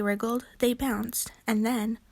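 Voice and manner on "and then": ordinary delivery, nothing marked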